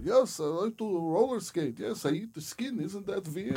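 squeaky mock Dutch villain voice; Goldmember impression. Host mimics Goldmember to a friend, high and squeaky. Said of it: "he's like".

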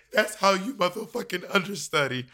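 a playful [name] impression breaking into laughter. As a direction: ham up a [name] pronouncement. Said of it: "it's like [name]".

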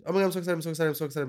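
excited babble, dropping in volume; real excitement. Real, not acted. Host to self before phoning a fan, giddy excitement. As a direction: repeat it giddily, winding down.

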